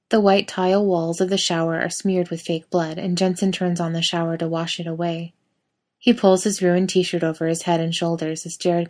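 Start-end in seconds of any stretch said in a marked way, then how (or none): none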